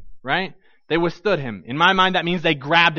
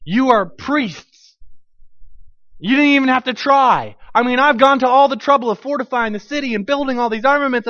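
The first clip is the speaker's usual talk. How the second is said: raised, higher indignant protest; angry king; 4 semitones higher, 5 dB louder than usual. Acted. King yelling at the priests about fairness, loud and indignant. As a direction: shout it like a spoiled king denied. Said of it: "and he says".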